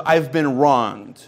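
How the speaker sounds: slow, low, wounded muttering; a hint of a sulking man, low and slow; aggrieved grumble. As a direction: sulk over being wronged, slowly. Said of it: "concept of".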